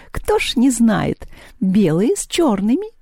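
high, drawn-out cat voice; knowing cat. A narrator's reading. The cat tells who fought in the yard, knowing. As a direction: answer slowly as if everyone knows.

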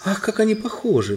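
high-pitched amazed cry; exclaiming. A narrator's reading. Queen marvels at two look-alike boys, exclaiming. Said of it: "вскричала королева".

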